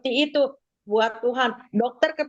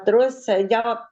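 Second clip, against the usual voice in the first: ordinary talk, nothing marked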